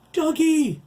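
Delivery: high, loud scream of a name; crying out. A narrator's reading. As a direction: scream for a child being snatched. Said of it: "cried out for him".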